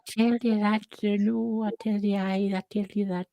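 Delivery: high, creepy stage Irish voice; a high, creepy mock Irish brogue. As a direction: lean in with a creepy leprechaun lilt. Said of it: "That sounds creepy".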